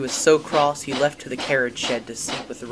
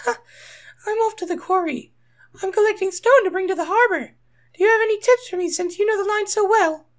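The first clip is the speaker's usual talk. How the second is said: Rosie's eager voice; 6 dB louder than usual. A narrator's reading. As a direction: sound keen and hopeful in a high voice. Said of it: "Her eyes glimmered".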